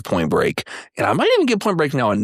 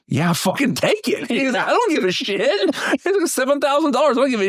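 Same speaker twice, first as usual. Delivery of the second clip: higher, offhand I don't care voice; careless guy; 11 semitones higher than usual. Retold or imitated. Quoting the truck buyer, higher and offhand. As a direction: toss it off like nothing matters.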